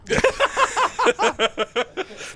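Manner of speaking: high, loud cackling laugh; burst of laughter. Real, not acted. Co-caster cracks up after teasing his partner, a high loud laugh. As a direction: crack up at your own joke.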